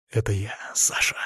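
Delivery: low, hushed whisper; whispered reveal. A narrator's reading. Hero reveals himself to his former friend, whispered. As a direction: whisper it close to his face.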